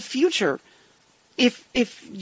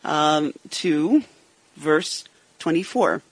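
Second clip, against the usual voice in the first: ordinary talk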